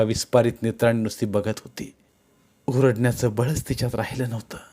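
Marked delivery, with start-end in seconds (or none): none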